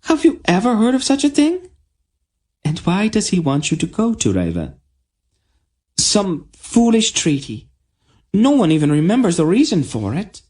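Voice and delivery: put-on high, fast, indignant girl; outraged princess. A narrator's reading. Tenedra to the queen about her father, high fast outrage. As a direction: complain in outrage.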